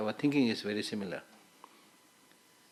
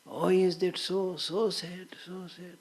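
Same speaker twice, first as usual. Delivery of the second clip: perfunctory sympathy; 7 semitones higher, slower than usual. Acted. Man told by ghost of another death, exaggerated token sympathy. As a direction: imitate hollow condolence in a raised voice. Repeats the token condolence, mocking.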